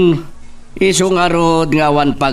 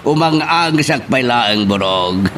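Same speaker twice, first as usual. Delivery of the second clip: normal talking voice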